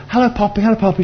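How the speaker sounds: high, sing-out mock hellos; high-pitched imitated hello; mock greeting. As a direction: imitate people calling out cheery greetings. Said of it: "all these people saying".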